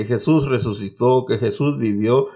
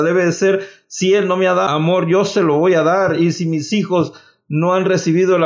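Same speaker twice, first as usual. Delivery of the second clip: higher, urgent sermon appeal; rally the flock to break the chains; preacher urging parents to love their fathers, fervent; preaching exhortation; 4 semitones higher than usual. Acted.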